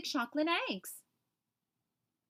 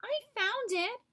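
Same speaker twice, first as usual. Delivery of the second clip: high little kitten squeak; 10 semitones higher than usual. A narrator's reading. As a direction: squeak it out in protest. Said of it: "Dottie squeaked".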